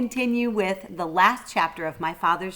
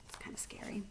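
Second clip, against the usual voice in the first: whispered aside; scary whisper; 20 dB quieter than usual. Real, not acted. Aside to young listeners.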